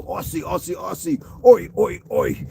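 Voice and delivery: amateur Aussie chant, rising then punched; shaky-attempt Australian accent. Greeting a viewer from Down Under, amateur Australian accent. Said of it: "I don't really have a good Australian accent".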